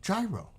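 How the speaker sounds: "Gyro" as JAI-roh, pitched high; New York gyro. Shown as a demonstration. New Yorker insisting on the word, "Gyro" as JAI-roh. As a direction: punch out "Gyro" high, with a j sound. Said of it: "as I'm pronouncing it".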